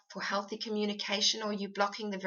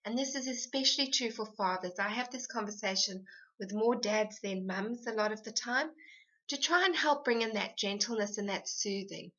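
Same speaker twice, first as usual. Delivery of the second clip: usual speaking voice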